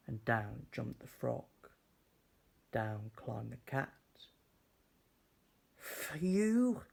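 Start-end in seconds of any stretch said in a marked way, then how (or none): 5.8-6.8 high, loud little dog voice; eager dog. Acted. Dog handing the witch her hat, high and eager. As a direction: offer it brightly like a helpful dog.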